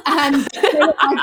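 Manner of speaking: usual speaking voice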